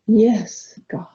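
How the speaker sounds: breathy whisper, no voice; hushed prayerful whisper. Shown as a demonstration. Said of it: "say the words out loud".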